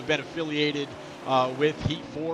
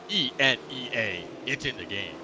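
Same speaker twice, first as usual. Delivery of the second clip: low, booming game intro announcer; deep gravelly voice; 4 semitones lower than usual. Retold or imitated. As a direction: rumble it like a video game trailer. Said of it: "in that deep, gravelly voice".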